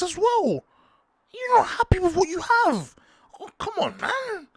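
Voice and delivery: very high, whining mock voice; squeaky high jealous moaning; jealous whiny voice. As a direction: whine it up high like a jealous fan. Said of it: "I'm speaking like this 'cause I'm jealous".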